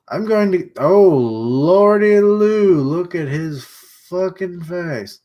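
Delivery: stretched, slow, high exclamation; shocked. Real, not acted. Reacting to the wounded man's face, slow and shocked. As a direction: drag it out in disbelief.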